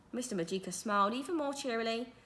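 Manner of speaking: plain reading voice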